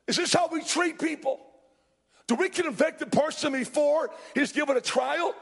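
high-pitched appeal to an angry crowd; preacher voicing an impassioned objection; indignant challenge. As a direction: call a halt, then press each question with a pause. Acted Nicodemus challenging an angry crowd.

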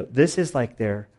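ordinary talk, nothing marked

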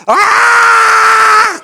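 high, loud shocked scream of pain. Retold or imitated. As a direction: yell as paint hits your eyes. Paint explodes in his eyes.

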